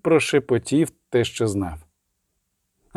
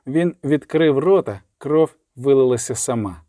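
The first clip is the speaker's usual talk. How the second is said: plain reading voice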